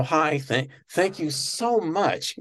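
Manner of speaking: high, slow gushing thank-you; profusely thankful. Retold or imitated. Guest imitating her gushing thanks to Johnny. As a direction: gush your thanks like a humble star. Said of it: "profusely thankful".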